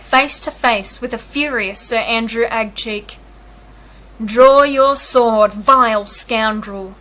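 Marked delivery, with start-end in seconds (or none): 4.2-6.9 slow, louder snarl. A narrator's reading. Sir Andrew snarling a challenge at Viola, slow and louder. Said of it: "snarled Sir Andrew".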